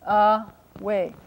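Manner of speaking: ordinary talk, nothing marked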